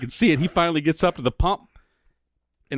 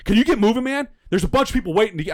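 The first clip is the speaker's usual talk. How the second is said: rude driver; 8 semitones higher than usual. Retold or imitated. Man four cars back demanding he move, pushy and raised. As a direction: quote the impatient driver with some push. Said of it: "He goes".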